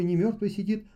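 ordinary delivery, nothing marked

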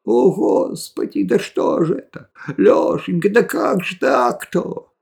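put-on high-pitched old woman wailing; high, fretful granny voice; lamenting granny. A narrator's reading. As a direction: lament like a fussing village grandmother. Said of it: "бабка всё причитала".

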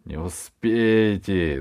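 slow, laughing barbarian. Acted. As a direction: laugh the words at him. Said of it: "засмеялся дикарь".

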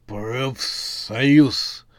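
the zombie says it distinctly, in an otherworldly voice; clear zombie voice. A narrator's reading. Zombie foreman repeats his demand to the witch, distinctly. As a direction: enunciate it like a proud dim zombie. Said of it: "чётко произнёс бригадир".

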